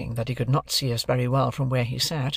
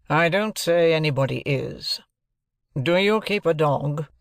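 raised, deliberate lawyer's voice; stern lawyer; 3 semitones higher, 3 dB louder than usual. Acted. Jaggers introducing himself to Joe and Pip, stern lawyer. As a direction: announce yourself like a cool London lawyer.

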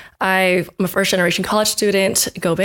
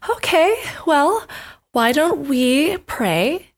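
gentle roommate; 5 semitones higher than usual. Retold or imitated. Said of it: "They just went".

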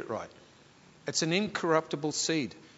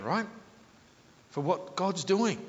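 ordinary talk

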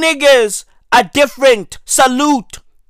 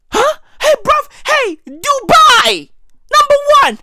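high, cracking mock wail; high crying mock protest; mock outcry; 14 semitones higher than usual. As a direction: squeal it like a shocked fan. Mock outcry about Dubai.

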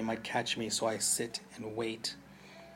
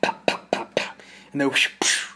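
loud popping then a whooshing blast; gunfire and blast; 3 semitones higher, 9 dB louder than usual. A narrator's reading. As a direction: hammer out the shots then the explosion.

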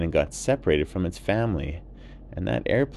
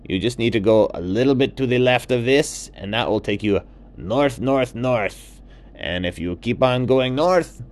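lightly higher, louder guiding toucan voice; toucan Juan giving directions; 5 semitones higher, 5 dB louder than usual. Acted. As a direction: point the way north, friendly and sure. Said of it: "And Juan said".

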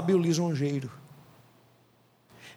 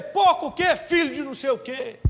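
offended comeback; 7 semitones higher than usual. Retold or imitated. Insulted driver answering the warning, high angry retort. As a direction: fire the insult right back. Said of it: "E ele".

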